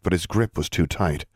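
plain reading voice

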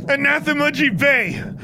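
high slow incantation shout; high spell cry against a ghost. A narrator's reading. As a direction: drag out the banishing spell. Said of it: "Alexandra shouted".

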